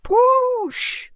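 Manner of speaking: very high whooshing wind sound; high airy whoosh of wind. Acted. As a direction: blow it like a sudden gust.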